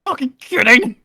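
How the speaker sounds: high, strained hissing outburst; angry hiss. Pretend. Player demonstrates hissing at the sneaky thief, angry hiss. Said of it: "I'm quietly hissing this at him".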